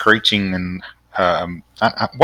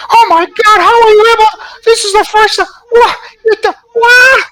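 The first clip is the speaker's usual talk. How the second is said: very high, louder, amazed chattering monkey; excited monkey voice; 6 dB louder than usual. Acted. Monkeys amazed that Marzipan can talk, very high excited squeal.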